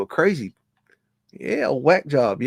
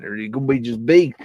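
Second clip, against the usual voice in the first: usual speaking voice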